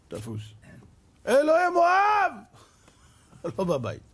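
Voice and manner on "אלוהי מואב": high, loud, slow cry for help; desperate shout. Retold or imitated. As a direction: call out to the next idol in despair. Manasseh calling idols in pot. (צעקה גבוהה ואיטית)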